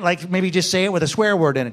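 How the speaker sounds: ordinary talk, nothing marked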